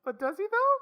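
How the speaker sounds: very high, loud mock-curious question; squeaky rising question; teasing squeak. Teasing echo of rude question.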